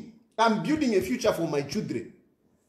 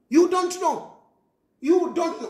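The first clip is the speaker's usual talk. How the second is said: emphatic warning; 6 semitones higher, 4 dB louder than usual. Real, not acted. Warning fathers.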